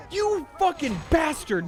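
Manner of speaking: high, loud, slow outraged yell; furious shout. Real, not acted. Gamer caught out by a random NPC, real furious yell. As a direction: explode at the game for getting caught.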